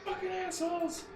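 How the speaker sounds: very high shrieking protest; screaming prisoner. Acted. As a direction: scream and beg to be let through.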